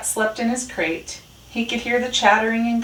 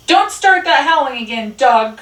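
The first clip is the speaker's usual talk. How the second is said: high, loud yell; crying out; 8 semitones higher, 9 dB louder than usual. A narrator's reading. Sleepless Rat yells at silent Dog, crying out. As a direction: yell the warning into the night. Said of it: "cried Rat".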